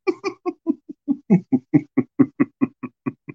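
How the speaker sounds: high giggling fit; real laughter. Real, not acted. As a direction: burst out laughing. Laughing at own impression.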